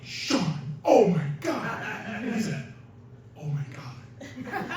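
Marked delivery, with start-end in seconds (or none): 0.0-1.8 mild mock scream, raised pitch; retold scream. Friend calling to Sean as the door opens, mock scream. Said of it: "And I scream". 3.4-4.7 slow, hushed imitation of amazement; awed whisper. Sean answering as the locked door opens, slow awed whisper.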